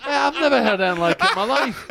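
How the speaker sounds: talking high through laughter; laughing. Real, not acted. Mocking a mate's music taste, laughing as he speaks. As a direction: laugh your way through the line.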